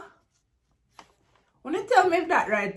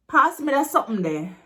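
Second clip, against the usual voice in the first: louder, higher Jamaican Patois demand; aggressive; 4 semitones higher, 4 dB louder, faster than usual. Shown as a demonstration. Teacher contrasting a polite request, aggressive Patois.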